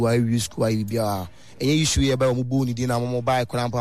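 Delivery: ordinary delivery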